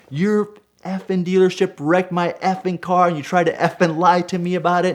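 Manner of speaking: high, sustained mock tirade; retold angry yelling. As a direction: reenact yelling someone down, pitch held high. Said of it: "I'm yelling over the desk".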